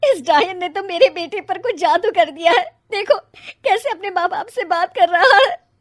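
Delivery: bitter lament. Acted. Mother laments after son leaves.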